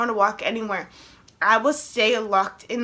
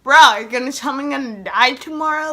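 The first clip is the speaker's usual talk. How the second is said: loud, incredulous outburst; acted disbelief; 3 semitones higher, 8 dB louder than usual. Patient reacting to doctor's apology, loud acted disbelief. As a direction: blurt it out in mock alarm.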